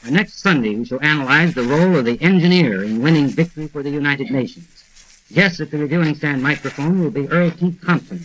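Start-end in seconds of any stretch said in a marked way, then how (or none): none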